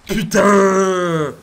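ordinary talk, nothing marked

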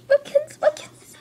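high, loud wail breaking into a whisper; crying out. Real, not acted. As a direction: cry the name out, then lose your voice. Crying out the toy's name.

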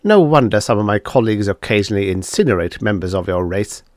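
usual speaking voice

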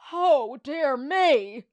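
loud, drawn-out posh dismay; dismayed cry. Acted. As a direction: cry out in slow embarrassment. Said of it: "he cried".

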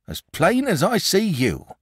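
a shepherd spinning a spooky tale by the fire; old shepherd. Acted. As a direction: tell it like a wary old country shepherd. Shepherd voiced in read story.

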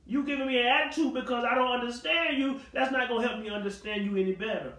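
normal talking voice

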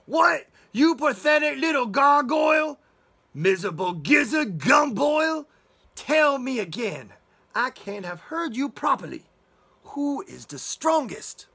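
a loud, high, outraged shout with slow insults; furious wolf. Acted. Wolf raging at the toad who names his mother, shouting. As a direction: explode at the toad in disbelief.

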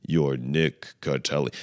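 slow, low, full-voiced chant; say-along affirmation. Self-help video leading a repeat-after-me line, slow intoned affirmation. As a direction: intone it deliberately for someone to repeat. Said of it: "Say that with me".

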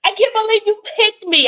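high-pitched "chosen one" gush; mock disbelief. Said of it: "How do people say".

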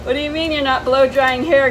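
ordinary talk, nothing marked

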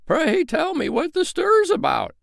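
high, sheep-like questioning; bleating sheep voice. Acted. Old sheep asking the crowd what the fuss is. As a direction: speak as a curious ewe. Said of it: "as if to ask".

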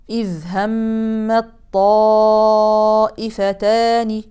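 همت طائفتان with the ta merged into the ta', slow; drawn-out tajweed reading, ta assimilated; slow recitation demo. Shown as a demonstration. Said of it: "تدغم تاء كلمة همت في الطاء". (إدغام التاء في الطاء)

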